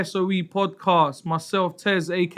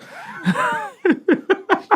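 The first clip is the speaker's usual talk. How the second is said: high-pitched burst of laughter; teasing, high cackle of laughter; laughing; 13 semitones higher than usual. Real, not acted. Cracks up after teasing co-host.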